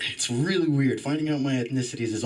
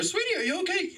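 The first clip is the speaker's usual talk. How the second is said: much higher, put-on mom voice; worried mom. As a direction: imitate a mother checking on her child. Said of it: "My mom bursts in the door".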